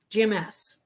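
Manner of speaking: ordinary talk, nothing marked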